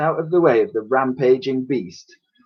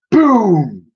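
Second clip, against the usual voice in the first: high loud boom; booming door thud; 9 semitones higher, 7 dB louder than usual. Acted. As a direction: bang it out like a battering ram. Door battering sound effect.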